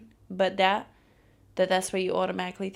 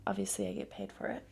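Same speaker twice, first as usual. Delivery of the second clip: whisper; 9 dB quieter, faster than usual. Acted. Confiding aside about the job.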